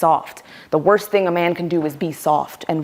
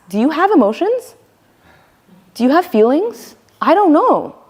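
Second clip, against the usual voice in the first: mock dismissive bro; 9 semitones higher, 3 dB louder than usual. Mocking bro asked about feelings.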